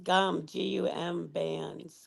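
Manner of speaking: slow careful spelling; spelled out slowly. Shown as a demonstration. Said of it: "We call 'em gum bands".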